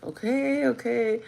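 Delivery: high-pitched uneasy-girl imitation; imitating young girl. Young girl whose hand won't be let go, imitated. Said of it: "she was like this. She was like".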